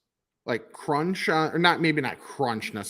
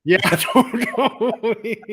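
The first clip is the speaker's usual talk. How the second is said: laughing; 6 semitones higher, 6 dB louder, much slower than usual. Real, not acted. Laughing agreement with cohost.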